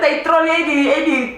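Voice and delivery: high laughing words. Real, not acted. Retelling the cashier days to her sister, laughing. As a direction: talk through a laugh.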